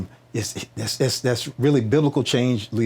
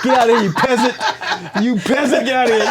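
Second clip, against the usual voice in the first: snooty star; 14 semitones higher, 6 dB louder than usual. Retold or imitated. Guest mocks the architect as a haughty star, high and laughing. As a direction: shoo the peasants away like a pompous celebrity. Star voiced shooing people away.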